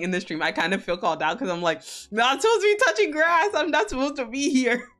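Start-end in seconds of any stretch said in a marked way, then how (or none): 2.1-4.9 high, fast, panicked squeak. Pretend. Her own panicked inner voice.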